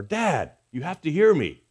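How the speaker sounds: high-pitched mock child's demand; insistent child. Child barging in on Dad, high insistent plea. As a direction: imitate a kid pressing a parent to listen. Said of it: "They come barging into the room and say".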